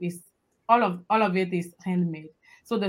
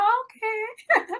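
high-pitched quote. Retold or imitated. Guest acts out people's reply.

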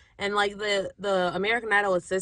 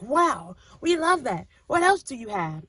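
higher, louder wowed judges, retold; begeistert; 5 semitones higher, 6 dB louder than usual. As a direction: imitate a delighted reaction, swooping high and loud. Retelling the producers' delighted reaction.

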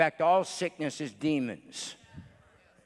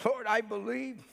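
retold crying out; 8 semitones higher than usual. Father cries out to Jesus for his boy, retold plea. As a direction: lightly act out a father's cry to God. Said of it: "And the father cried out".

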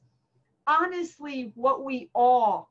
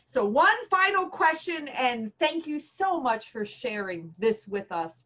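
ordinary delivery

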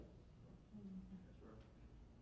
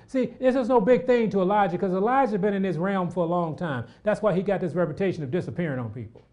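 high, loud preaching, settling down; fired-up preacher voice; preaching at full force; 6 semitones higher, 5 dB louder than usual. Acted. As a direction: lift the congregation, then ease off. Preaching rises sharply.

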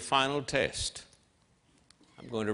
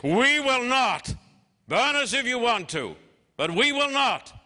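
defiant refusal; 10 semitones higher, 5 dB louder than usual. Retold or imitated. Shadrach, Meshach and Abednego defy the furnace, loud and unbending.

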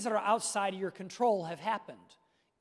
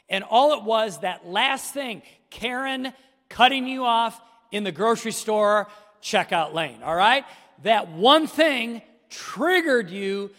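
emphatic preaching; 3 semitones higher, 10 dB louder than usual. Acted. Pastor pressing the congregation on anger. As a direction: build it up like a preacher making his point.

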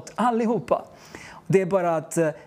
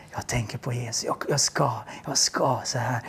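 softly, lower, hushed; whispered reply; 7 semitones lower, 4 dB quieter than usual. Retold or imitated. The girl whispering back to the preacher. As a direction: answer in secret, barely voiced.

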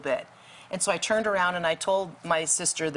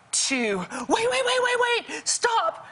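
panicked cry to stop; slower than usual. Retold or imitated. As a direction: break off the count in terror. Sister panics mid count.

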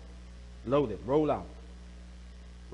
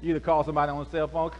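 usual speaking voice

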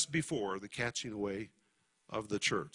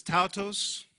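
higher, slow emphatic delivery; raised voice, emphatic; 3 semitones higher, much slower than usual. Acted. Preacher voicing Paul's urgent words to the church, emphatic. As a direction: proclaim each phrase with weight. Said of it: "raising his voice emphatically".

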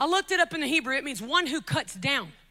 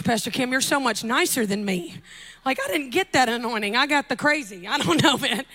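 ordinary talk, nothing marked

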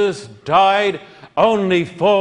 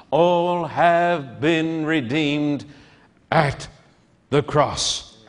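slow solemn refrain; 2 semitones lower, slower than usual. Acted. Sermon refrain, slowing down.

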